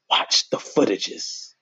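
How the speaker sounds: slow, breathless whispered repeat. Real, not acted. Teasing viewers to rewatch the footage, slow whisper.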